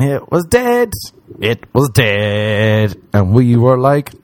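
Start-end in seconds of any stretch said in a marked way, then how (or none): 0.0-0.9 high-pitched comic dismay; exclaimed letdown. Retold or imitated. 1.4-3.0 very slow, stretched-out repeat; slow mock-dismayed emphasis, falling at the end; drawn-out letdown.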